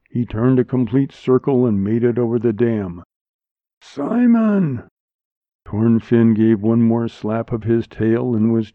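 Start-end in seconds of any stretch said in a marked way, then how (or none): 3.8-4.9 exultant cry. A narrator's reading. Cry as fish clears dam.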